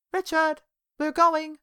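loud high call across the lot; shouted out. A narrator's reading. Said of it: "Susu shouted out".

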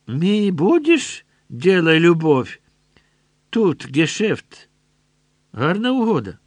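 a soldier sweet-talking with menace; leering soldier. A narrator's reading.

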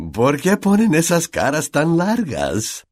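slow, high, jolly teacher voice; cheerful Lockhart. A narrator's reading. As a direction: ask it with showy, drawn-out cheer. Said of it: "Lockhart estaba tan alegre como siempre".

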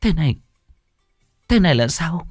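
high, halting, tearful questions; confused sobbing. Acted. Sobbing girl asking her mother what is happening. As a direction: ask through sobs, lost. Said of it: "hỏi câu được câu trăng".

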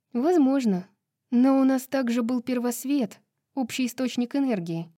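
put-on lightly animated young-woman dialogue; chatty heroine.

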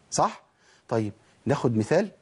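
normal talking voice

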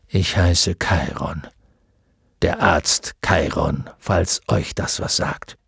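name himself with tired dignity; weary centaur. A narrator's reading. Centaur introducing himself, exhausted.

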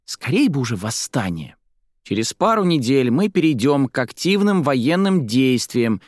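put-on high-pitched girl reading from a newspaper; high, animated child's read-aloud. A narrator's reading. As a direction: read it out keenly like a kid. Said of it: "читала из газеты Марца".

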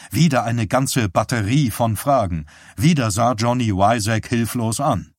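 plain reading voice

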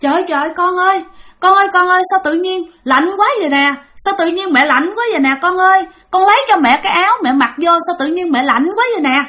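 put-on high-pitched, urgent wail to a child; retold motherly cry, high and agitated; crying out in alarm. Mother suddenly freezing calls her child for a shirt, crying out. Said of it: "mẹ mới la lên".

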